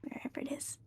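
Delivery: whispering. Acted. Character whispers in play.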